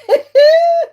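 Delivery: very high, loud laughing squeal; real laughter cutting off her sentence. Real, not acted. Cracks up mid sentence.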